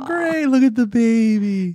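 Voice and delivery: high, slow mock delight; sarcastic. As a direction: fake excitement, dripping with sarcasm. Family mocking the baby reveal.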